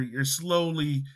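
usual speaking voice

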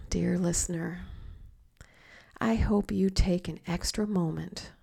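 slow, hushed meditation voice with long pauses; guided meditation. Acted. Coach leading listeners through grateful mealtime visualisation, slow guided meditation. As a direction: guide the listener gently, letting each phrase settle.

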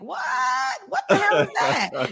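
high-pitched baffled outcry; mock shock. Host mimics people hearing her co-host speak, mock shock. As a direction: imitate listeners thrown by what they hear.

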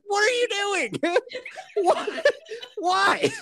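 high, laughing what are you doing; half-laughing, half-horrified; high squeaky laughing disbelief; laughing alarm. Retold or imitated. Father's alarmed reaction retold, laughing.

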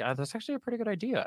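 quick, high musing aside in John's role; quoted John. Retold or imitated. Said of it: "John's like".

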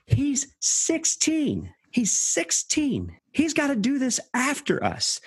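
pleading younger man. Retold or imitated. Retelling the younger man begging the older one, high and pleading. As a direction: cry out that the boy is only sixteen, slowly. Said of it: "And he says".

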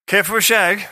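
high, cheeky British come-on; mock British accent. Comic body part propositions co-host, cheeky mock British. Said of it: "is fucking British now".

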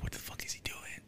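hushed, unvoiced whisper; whispering while grandpa creeps up to the door. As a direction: whisper it so he can't hear. Hearing grandpa sneak to door.

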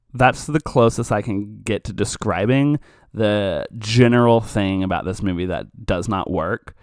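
ordinary talk

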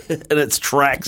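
laughing through the words. Real, not acted. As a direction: chuckle out the phrase. Host laughs while finishing the line.

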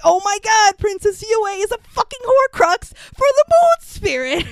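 high, loud, thrilled realization; gushing fangirl excitement; excited. Retold or imitated. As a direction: blurt it out like a big discovery. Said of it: "I'm like".

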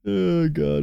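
normal talking voice

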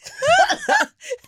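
high, loud burst of laughing; real loud laughter. Real, not acted. Storyteller laughs about getting parables for stories, loud and high. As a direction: crack up completely.

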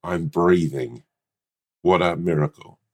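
slow, low and solemn; solemn breathing mantra. Acted. Guru teaching his friend to breathe, slow and solemn. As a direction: intone it slowly like a spiritual guru. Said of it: "Why do you have to say".

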